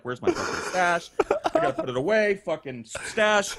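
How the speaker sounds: rushed panicky muttering; frantic, hurried search for the stash; panicked. Retold or imitated. Panicked hiding stash from cop.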